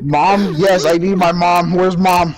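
loud, high wail for help; panicked cry. Real, not acted. Scared player calling for mom, high loud wail.

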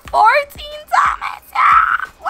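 high, loud victory shout; triumphant. Real, not acted. Gamer to viewers, cheering fourteen diamonds loudly. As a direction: cheer the haul.

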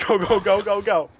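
loud rapid command; urgent urging. Real, not acted. Urging teammates to run away, loud and urgent.

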